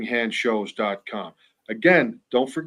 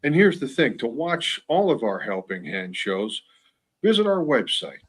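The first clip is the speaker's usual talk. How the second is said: ordinary delivery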